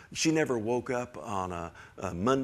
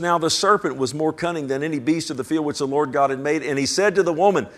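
louder, preached reading; scripture reading at full force; 3 semitones higher, 7 dB louder than usual. Acted. Preacher reading Genesis aloud to the congregation, loud. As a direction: proclaim the verse.

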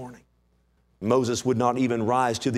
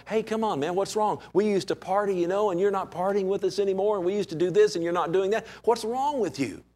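put-on old party buddy; 6 semitones higher than usual. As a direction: whine like a friend you stopped partying with. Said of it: "Are they going".

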